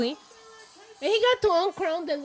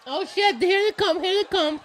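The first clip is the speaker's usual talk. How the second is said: wrestling commentator; 6 semitones higher, 7 dB louder than usual. Real, not acted. Watching the match live, reacting.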